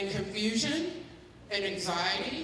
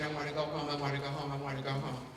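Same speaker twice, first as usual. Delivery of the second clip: mock tearful plea; 6 semitones lower, 6 dB quieter, faster than usual. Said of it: "they're crying and they're saying".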